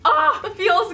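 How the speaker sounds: high, loud ah the feels; loud gushing delight. Real, not acted. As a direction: gush over it, loud and high. Moved by the gift.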